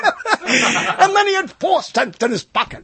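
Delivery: high, drawn-out melodrama through laughter; hammed-up sob story. Host reading the founder's life story, laughing mock melodrama. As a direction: push the mock biography way up, cracking up.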